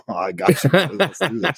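laughing reassurance. Retold or imitated. Dad reassures son, laughing.

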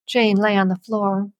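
usual speaking voice

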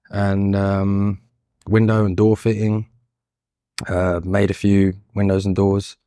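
usual speaking voice

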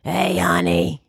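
slow, rasping greeting; rough, drawn-out put-on voice; Whoopi Goldberg. Released prisoner greeting someone like a hardened veteran, slow rasp. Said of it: "talking like Whoopi Goldberg".

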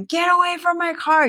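very high shrill yell; screaming at thieves. Retold or imitated. Catching frat boys in her car, very high yell. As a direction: yell at them to back off. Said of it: "screaming at them".

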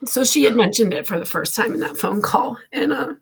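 real crying. Real, not acted. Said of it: "I'm gonna get emotional".

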